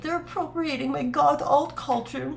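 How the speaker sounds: high, whiny complaint voice; mock a pouty rich girl.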